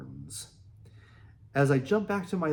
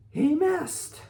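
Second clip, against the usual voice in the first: high, loud burst of relief; excited; 14 semitones higher, 5 dB louder than usual. Retold or imitated. Narrator realizes the bull missed him, excited cry. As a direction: blurt it out in disbelief.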